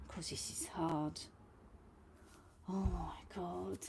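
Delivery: whispered, exasperated aside; frustrated whisper. Real, not acted. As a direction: breathe it out under your breath in frustration.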